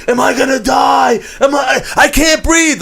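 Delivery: imitating a friend's panic attack; panicked screaming. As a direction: scream it like you think you're dying. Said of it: "he's screaming".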